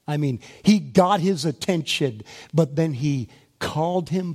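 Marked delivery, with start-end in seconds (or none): none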